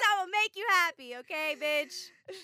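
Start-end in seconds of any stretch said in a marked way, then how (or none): 1.2-2.4 slow, higher sass on okay; co-host closing her pep talk with sass; sassy drawn-out tag. Real, not acted. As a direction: stretch it out with attitude.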